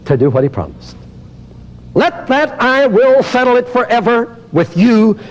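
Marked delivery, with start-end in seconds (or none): 1.9-5.2 high, ringing preacher's proclamation; preaching declaration. Acted. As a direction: proclaim it to the congregation. Preacher declaring at full force.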